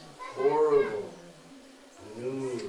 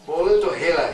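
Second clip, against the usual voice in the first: Bolan to hela, louder and punched; emphatic recitation; 3 semitones higher, 8 dB louder than usual. Shown as a demonstration. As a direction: hit Bolan to hela hard. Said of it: "Hela means just like".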